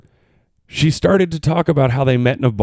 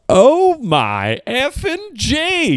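stunned disbelief; 12 semitones higher, much slower than usual. Real, not acted. Amazed at allergic-to-person fact.

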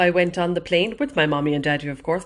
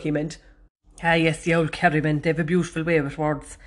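ordinary talk

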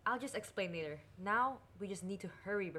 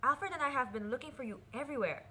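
high, loud scolding; vexed; 7 semitones higher, 18 dB louder than usual. Acted. Princess finds the missing boy after searching, vexed. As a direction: call out and reproach, exasperated. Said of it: "Princess Esma here was quite vexed".

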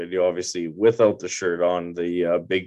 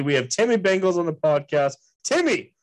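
hype intro; 9 semitones higher than usual. Real, not acted. Host hyping guest intro.

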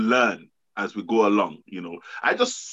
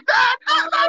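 much higher, louder, panicked plea for dad; frantic daughter calling; 5 dB louder than usual. Retold or imitated. Daughter at the train station begging her dad for help, panicked high plea. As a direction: imitate a daughter's desperate cry down the phone. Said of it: "you're like".

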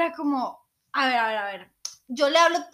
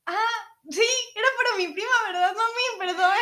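put-on cheeky child; 8 semitones higher, 4 dB louder than usual. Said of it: "es como".

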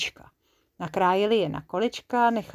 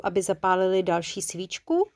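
ordinary delivery

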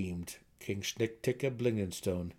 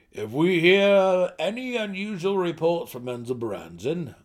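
louder, measured royal voice; a king steadying his nervous court; commanding gnome ruler; 8 semitones higher, 9 dB louder than usual. Acted. King continues orders.

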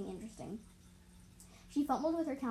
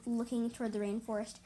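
plain reading voice

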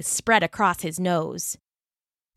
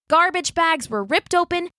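ordinary delivery

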